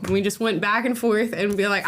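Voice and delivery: normal talking voice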